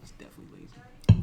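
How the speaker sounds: voiceless, under-the-breath remark; whispered jibe. Pretend. Podcaster repeating a jibe to co-host, whispered. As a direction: whisper it as a sly aside.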